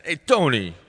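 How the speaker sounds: put-on Brooklyn Italian accent. Dancer redoing her West Side Story line in a Brooklyn Italian accent. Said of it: "Brooklyn Italian accent".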